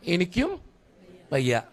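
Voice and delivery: low, soft mock-tired reply; a hint of a weary Lord saying he is done too; God answering wearily. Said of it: "കർത്താവും നമ്മളോട് പറയും".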